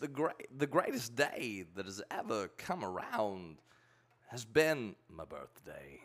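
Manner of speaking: halting celebrity impersonation, sinking at the end; Christopher Walken. Shown as a demonstration. Showing off a stock impression, falling away on the punchline. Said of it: "'cause he starts off high".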